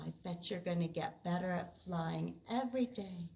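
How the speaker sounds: ordinary delivery, nothing marked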